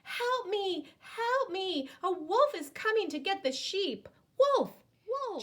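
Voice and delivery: high, loud cry for help; boy shouting down to his father for help, urgent. Acted. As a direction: yell down the hill to raise the alarm. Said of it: "So Joseph shouted down to his father".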